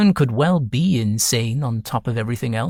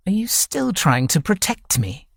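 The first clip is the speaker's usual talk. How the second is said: sharp, higher snap; snapped; 7 semitones higher than usual. A narrator's reading. Ned snaps at his foster mother, sharp and high. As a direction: bite the question back at her. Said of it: "Ned snapped".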